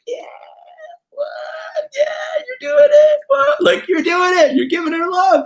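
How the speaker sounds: very high, crying shout of delight; squealing over-the-top cheer from the back; grandpa cheering. Retold or imitated. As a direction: cheer the kid on from across the room.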